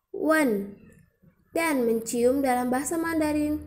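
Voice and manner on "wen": "Wen" stamped with a quick fall; fourth tone. Shown as a demonstration. Teacher repeating Mandarin for to ask, fourth-tone falling "Wen". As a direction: hit "Wen" firmly and drop it. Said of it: "nada empat".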